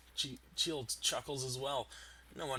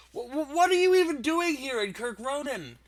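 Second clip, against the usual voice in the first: stammering, higher and louder panic; terrified chef; 14 semitones higher, 13 dB louder than usual. Acted. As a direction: stutter out the question in fear. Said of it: "asks the terrified chef".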